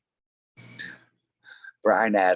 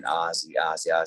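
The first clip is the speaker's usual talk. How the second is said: ordinary talk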